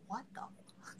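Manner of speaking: breathy shocked whisper. Retold or imitated. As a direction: whisper it in disbelief. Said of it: "and I'm like".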